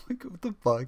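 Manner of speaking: usual speaking voice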